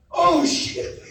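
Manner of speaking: high, loud alarmed oh shit with a laugh; startled. Retold or imitated. Bike pops a wheelie, startled loud curse. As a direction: caught off guard as the bike rears up.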